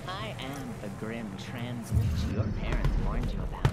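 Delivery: a hint of a grand menacing witch; dramatic villain. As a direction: declaim it slowly like a dark witch. Game character voice line flourish.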